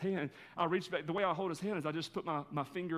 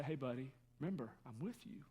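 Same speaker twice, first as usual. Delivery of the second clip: soft reassurance; 4 semitones lower, 10 dB quieter than usual. Retold or imitated. Father reassuring son in car.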